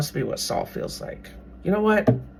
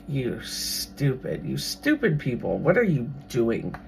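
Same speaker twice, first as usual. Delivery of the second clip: mild mock Goliath; 4 semitones lower, 7 dB quieter than usual. Goliath imagined mocking Israelites.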